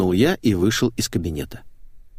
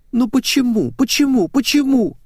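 very high, louder repeated wail; whining; 4 dB louder than usual. A narrator's reading. Светик lamenting to her colleague, whining. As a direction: whine it like a wronged child. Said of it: "заныла Светик".

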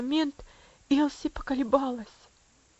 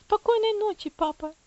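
put-on high girl's voice, a mild sob; sobbing goodnight; 10 semitones higher, 5 dB louder than usual. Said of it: "всхлипнула девочка". (высоким голосом, всхлипывая)

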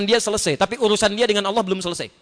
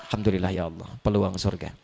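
low, soft, grateful; soft whispered gratitude; calm grateful murmur; 12 semitones lower, 7 dB quieter than usual. Pretend. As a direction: answer anger with quiet thanks. Calm reply to angry person.

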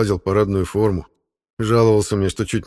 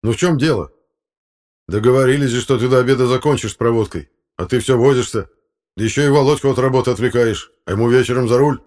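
loud, high-pitched scolding; reproachful; 5 semitones higher, 6 dB louder than usual. Acted. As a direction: tell him off like an exasperated boss. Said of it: "укоризненно воскликнул Фёдор Игнатьевич". (громко, с укоризной)

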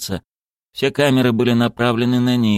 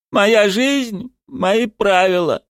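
«Моя жизнь, мои правила» high, trembling but distinct; 13 semitones higher, much slower than usual. A narrator's reading. Zagir repeats it for everyone, «Моя жизнь, мои правила» in a trembling voice. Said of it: "произнёс Загир дрожащим голосом".

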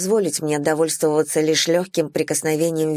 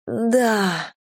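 higher-pitched pleading yes; 4 semitones higher than usual. A narrator's reading. A woman answering her lover, pleading. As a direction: beg with a single word. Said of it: "В моем голосе слышится мольба".

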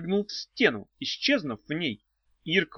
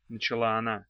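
ordinary delivery, nothing marked

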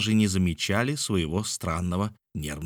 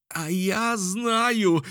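high, long-drawn exclamation; exclaiming; 12 semitones higher, much slower than usual. A narrator's reading. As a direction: cry it out, stretching each word. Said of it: "воскликнул Мишель". (протяжный возглас)

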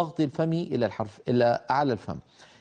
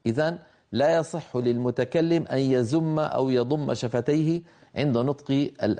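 normal talking voice

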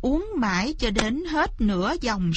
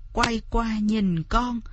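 ordinary delivery

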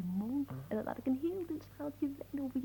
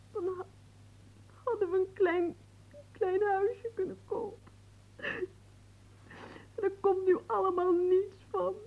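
tearful lament that rises in pitch; a fiancée weeping over dreams that will never come true; crying; 7 semitones higher, 5 dB louder than usual. Acted. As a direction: sob over the lost little house. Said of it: "Als je nu de verdere dag gaat huilen".

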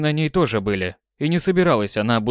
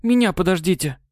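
wistful plea; 7 semitones higher than usual. Acted. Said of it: "почему-то тоскливо попросила Светлана".

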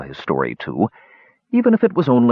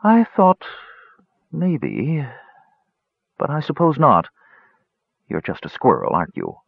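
murmuring cat; 5 semitones higher than usual. Acted.

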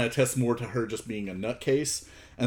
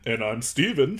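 put-on voice of a twin co-host; mock second host. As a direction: answer yourself as the other, less excitable host. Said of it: "I could just talk to myself".

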